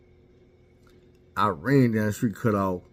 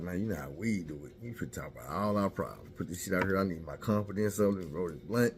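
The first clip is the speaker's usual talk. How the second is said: low, laid-back; 4 semitones lower, 7 dB quieter than usual. Retold or imitated. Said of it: "I'm like".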